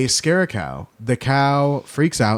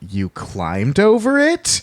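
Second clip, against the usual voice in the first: mildly outraged disbelief, rising higher; higher-pitched mock indignation; mock indignant; 8 semitones higher, slower than usual. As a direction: act out an incredulous complaint. Acted indignant milkmaid confronting the gang.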